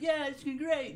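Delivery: put-on high, loud chirpy kid; cheerful mock kid. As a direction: sing it out, naive and happy. Mock kid going into haunted house.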